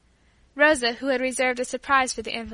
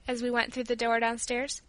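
ordinary delivery

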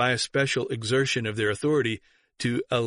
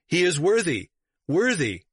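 higher, emphatic acclamation; mild narrated shout; 6 semitones higher than usual. A narrator's reading. Bishops of the East backing Theodoret, mild shout. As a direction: cry out approval with restrained volume. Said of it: "shouted out".

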